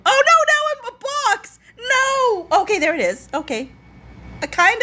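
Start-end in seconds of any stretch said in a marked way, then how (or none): 0.1-2.5 high, rapid comic panic; startled high-pitched wail; alarmed cry. Real, not acted. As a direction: yelp in dismay at the mishap. Alarmed as screen layout changes.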